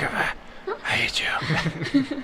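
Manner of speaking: slow, whispered resentment; acted exhausted whisper; breathless mock hatred. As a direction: wheeze it out in a whisper, as if spent. Retells exhausted reaction to the coach.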